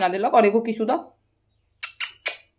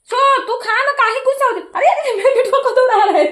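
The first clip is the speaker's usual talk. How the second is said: high coaxing pet voice, a little louder; pet talk; 12 semitones higher, 4 dB louder than usual. Real, not acted. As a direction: coo at the parrot in a high voice. Coaxing her pet parrot.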